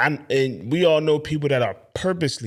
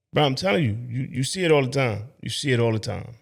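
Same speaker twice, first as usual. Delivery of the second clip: ordinary talk, nothing marked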